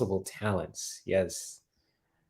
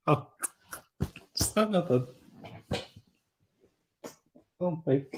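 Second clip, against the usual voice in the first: helpless laughter; 7 semitones higher, 5 dB quieter than usual. Real, not acted. Cracked up by Lisa's comment.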